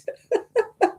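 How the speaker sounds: a high, squealing giggle; high squeal of laughter; high squeaky laugh. Real, not acted. After retelling the refund.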